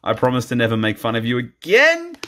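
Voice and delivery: normal talking voice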